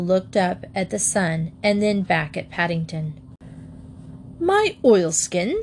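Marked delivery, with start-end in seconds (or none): 4.4-5.6 high, slow and haughty. A narrator's reading. Paddington offended by the Admiral's stare, haughty and slow. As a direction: draw it out, offended and superior. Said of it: "said Paddington haughtily".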